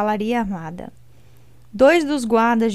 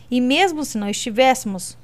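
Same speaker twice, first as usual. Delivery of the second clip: plain reading voice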